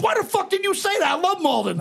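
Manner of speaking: light up at the news; excited disbelief. Retold or imitated. Dorchester kid learning the narrator is from Malden, excited. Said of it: "He goes, he goes".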